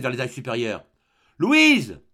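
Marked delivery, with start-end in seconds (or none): 1.4-2.0 loud, high yell of a name; sharp shouted summons; vociferating. A narrator's reading. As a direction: bellow upstairs for your wife. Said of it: "il vociféra, en levant la tête".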